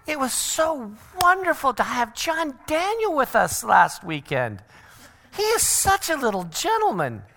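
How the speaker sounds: very high, hammed-up delight; gushing parent. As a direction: gush sweetly over someone else's child. Another parent praising his child, acted.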